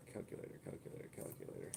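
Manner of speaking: breathless whispered mutter while searching; whispered calculator muttered under the breath. Real, not acted.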